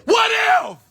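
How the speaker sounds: imagined shout. Retold or imitated. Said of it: "I believe he shouted it".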